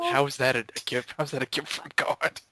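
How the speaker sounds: ordinary delivery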